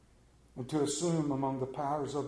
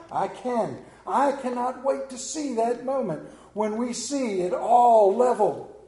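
loud, higher preaching cry about heaven; full-force preaching; 8 semitones higher, 10 dB louder than usual. Real, not acted. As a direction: preach it out with fervour, loud and high.

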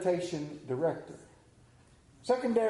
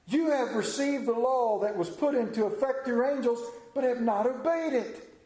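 higher-pitched, forceful declamation; scripture accusation; 6 semitones higher than usual. Acted. Preacher reading Stephen's charge against the council, declaimed and accusing. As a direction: drive the charge home, pitch held high.

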